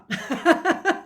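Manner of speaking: loud high laugh at her own joke; burst of laughter. Real, not acted.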